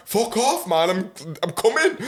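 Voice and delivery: high laughing fake annoyance; answering a friend who keeps calling, laughing; laughing mock retort. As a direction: laugh through a mock brush-off.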